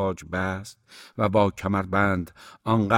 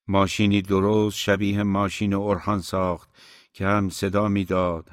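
ordinary delivery, nothing marked

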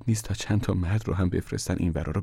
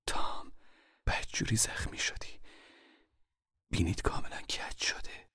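urgent whisper in the dark; hushed whisper; 11 dB quieter than usual. Acted. Mother whispering warnings to her hiding son. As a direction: keep it breathy and secret. Mother touching his face.

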